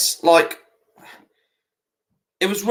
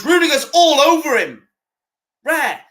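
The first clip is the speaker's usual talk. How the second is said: excited commentary; 10 semitones higher, 3 dB louder than usual. Real, not acted. Commentating a tackle in a watch-along.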